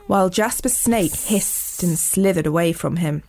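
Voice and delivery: usual speaking voice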